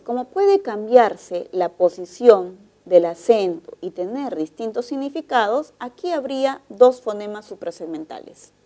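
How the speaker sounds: ordinary delivery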